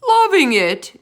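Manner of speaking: loud, high outraged giant yell. A narrator's reading. Bloodbottler scorning the vegetable, in an outraged yell. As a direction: hurl the words back in disgust. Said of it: "yelled the blood bottler".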